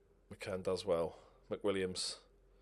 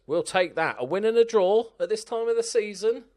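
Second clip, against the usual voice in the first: excited shout; 10 semitones higher, 9 dB louder than usual. Real, not acted. Celebrating a win to players.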